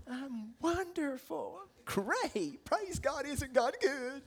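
high, sugary put-on wonderful; fake cheerfulness. Mad churchgoer covering up at church, falsely cheerful. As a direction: overdo the pious good cheer. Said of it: "you're lying right from your heart".

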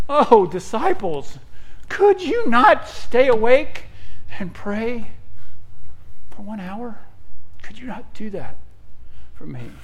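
high, drawn-out imitation of a sorrowful plea; sad pleading. Jesus to the sleeping disciples, acted sad pleading. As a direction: plead in acted disappointment, stretching the words. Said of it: "in a sad, sad setting".

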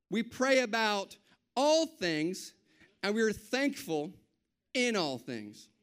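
slow, emphatic preaching recap. Acted. Pastor to church, drilling the verse slowly. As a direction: lay each phrase down slowly.

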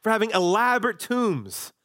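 mock-sarcastic scoff. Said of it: "See, they're being sarcastic".

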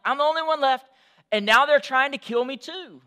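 Elijah's frustrated outcry to God; frustration. Acted. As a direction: make them hear the frustration. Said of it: "hear the frustration in his voice".

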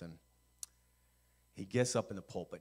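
usual speaking voice

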